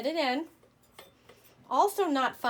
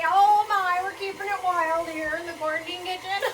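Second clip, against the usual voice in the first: loud, higher laughing exclamation; laughing surprise; 7 semitones higher, 10 dB louder than usual. Real, not acted. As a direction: laugh as batter splatters. Mixer splatters batter everywhere.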